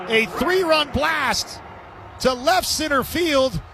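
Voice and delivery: rising, shouted play-by-play as the ball leaves; excited home run call. Real, not acted. Announcer calling a home run to listeners, shouting. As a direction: belt it out as it clears the fence.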